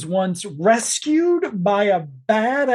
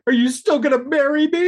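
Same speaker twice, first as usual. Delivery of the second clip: pleading fiancée; 6 semitones higher, faster than usual. Retold or imitated. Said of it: "And she's like".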